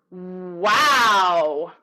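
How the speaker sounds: "wow" very drawn out, swelling from a small ooh to a big ah; "wow" as a long swooping warm-up; ooh-to-ah glide. Shown as a demonstration. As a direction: open "wow" slowly from small to loud. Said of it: "have a kind of a ooh before it".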